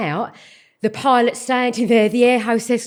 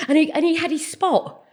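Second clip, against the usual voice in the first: ordinary talk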